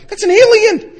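very high, loud mock scream; comic hysteria at full pitch; horrified shriek. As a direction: shriek it in appalled disbelief. Acts horror at newborn's look.

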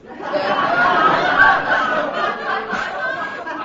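high squealing laugh; shrieking laugh; high laughter. Real, not acted. Laughter after Beverly Hills line.

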